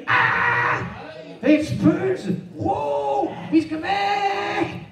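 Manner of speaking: high, loud cries of terror; panicked screaming. A narrator's reading. Frightened men at sea see a ghost, panicked screaming. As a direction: shriek in mock terror, drawing out the last words. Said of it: "Så de skriger".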